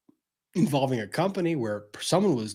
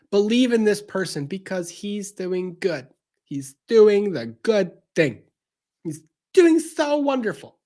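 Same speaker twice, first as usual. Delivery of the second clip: sugary mock pitch, rising much higher to the end; mocking celebrity endorser; 7 semitones higher, 4 dB louder than usual. Celebrities selling him to fans, syrupy mock praise. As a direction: parrot the ad stars in a syrupy sing-song.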